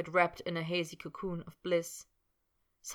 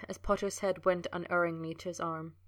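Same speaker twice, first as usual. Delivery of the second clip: usual speaking voice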